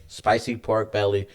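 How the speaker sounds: normal talking voice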